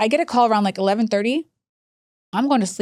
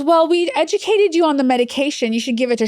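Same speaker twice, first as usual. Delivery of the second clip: lightly voiced agency worker, pitched up; imitated agency worker; 6 semitones higher than usual. Agency worker deflecting the daughter's complaint, mildly mocked. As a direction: put on a higher, excuse-making official voice.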